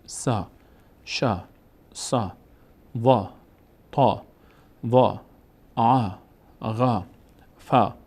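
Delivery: each syllable from تا to یا alone with a long aa; long aa drill. Shown as a demonstration. Teacher drilling the alphabet for learners, long aa on each syllable. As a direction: recite تا، ثا، جا one at a time, vowels held. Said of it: "صدای کشیدهٔ آ بودن".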